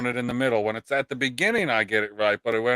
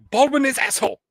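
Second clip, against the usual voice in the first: higher-pitched put-on Chinese accent; mock Chinese accent; 9 semitones higher, 4 dB louder, faster than usual. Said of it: "say that in a Chinese accent".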